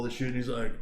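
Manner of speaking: ordinary talk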